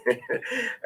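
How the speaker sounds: bright high laugh. Real, not acted. Laughs at co-host.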